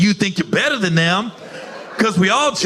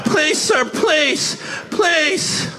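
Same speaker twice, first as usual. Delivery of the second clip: higher, whiny pleading lady voice; pleading woman passenger; 8 semitones higher, slower than usual. Retold or imitated. Woman asking him to switch seats, high mock pleading. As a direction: beg like a wife wanting her husband's seat.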